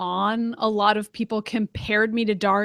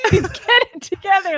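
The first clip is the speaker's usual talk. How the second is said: high, laughing mock scolding; hammed-up reprimand through giggles; 10 semitones higher, 3 dB louder than usual. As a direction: play the telling-off while cracking up. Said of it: "I can't believe I'm laughing at that story".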